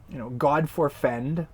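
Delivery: normal talking voice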